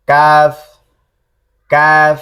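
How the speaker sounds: কাফ light, a little forward of qaf, repeated; Arabic kaf demo. Shown as a demonstration. Teacher contrasts kaf with qaf for students, light and clear. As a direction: say কাফ lightly with the tongue just ahead of the root. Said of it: "পেছনে কিন্তু হালকা".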